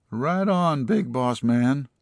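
high, slow laid-back hipster voice; goofy deputy voice. Acted. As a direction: drawl it out like a cool slacker. Deputy Delbert voiced in story.

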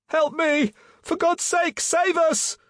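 very high, loud cry for help; desperate cry. A narrator's reading. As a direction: cry out across the water like a drowning man. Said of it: "came a desperate cry across the water".

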